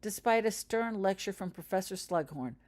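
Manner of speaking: ordinary delivery, nothing marked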